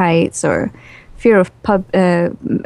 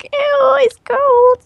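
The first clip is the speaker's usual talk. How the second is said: very high, squealing disgust at the cold; squeamish body voice; much slower than usual. Retold or imitated. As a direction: squeal it like a startled child touching something cold. Body touches cold snake.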